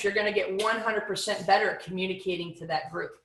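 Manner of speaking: usual speaking voice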